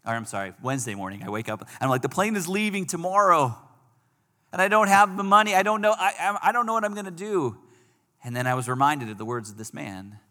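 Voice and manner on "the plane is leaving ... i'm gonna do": retold panic. Retold panic the day before.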